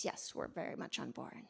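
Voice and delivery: dry whispered aside, tongue in cheek; sarcastic whisper. Real, not acted. Speaker mocking people who like the serious label, whispered aside. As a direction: mutter it under your breath, mock agreeing.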